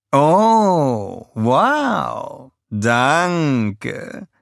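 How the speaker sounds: high, cooing thanks; breathy vintage purr. A narrator's reading. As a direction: coo it like a diva. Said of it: "säuselte sie".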